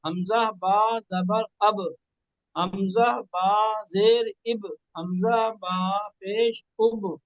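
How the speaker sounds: each syllable spaced out slowly; slow spelling demo. Shown as a demonstration. Teacher spells slowly for student. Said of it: "इस तरह अब".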